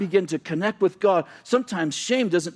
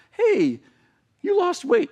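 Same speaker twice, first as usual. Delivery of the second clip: mock cheery friend; slower than usual. Mocking helpful church people.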